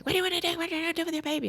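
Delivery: high, urgent mock yell at the mother. Doctor urgently yelling at the mother, mock yell. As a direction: retell the doctor's urgent barked question. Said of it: "yelling at me".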